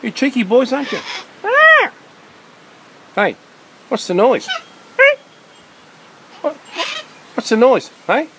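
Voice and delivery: very high, loud sing-song calls to a cockatoo; bird-talk voice. Real, not acted. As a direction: call up to the parrot like talking to a pet bird. Calling to cockatoos in trees.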